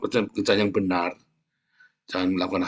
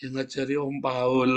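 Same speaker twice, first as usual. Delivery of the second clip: laughing; 3 semitones higher, slower than usual. Retold or imitated. Child answering, laughing.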